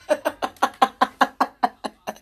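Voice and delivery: staccato real laughter, dying away; laughing fit. Real, not acted. Laughing at own mockery, real laughing fit. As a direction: crack up in quick pulses.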